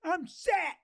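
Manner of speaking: high-pitched mock bragging; carefree broke kid. Host imitates kid who found twenty dollars, cocky. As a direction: play a kid suddenly flush and cocky.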